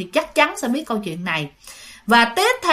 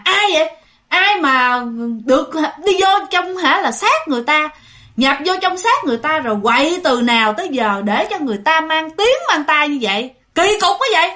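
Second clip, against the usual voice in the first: scolding shout; 5 semitones higher than usual. Retold or imitated. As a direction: snap at her like an angry monk. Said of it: "ổng mới nạt nộ". (giọng quát nạt giận dữ)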